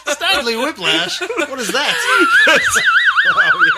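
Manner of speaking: high, wheezing laughter through words; real giggling disbelief; laughing. Real, not acted. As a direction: squeak it out while laughing hard. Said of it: "The wheezing".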